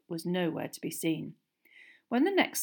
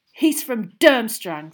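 slow, loud, higher angry accusation; spat out; 4 semitones higher, 13 dB louder, slower than usual. A narrator's reading. Boy accusing his friend over her date's school, spitting. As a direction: spit it out with heavy force. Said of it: "spat Ron".

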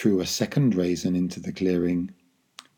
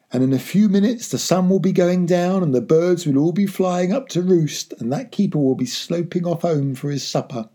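high, eager and energetic; excited scheming; 8 semitones higher, 5 dB louder than usual. A narrator's reading. Father telling son the rest of the poaching plan, excitedly. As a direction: build to a loud, gleeful finish.

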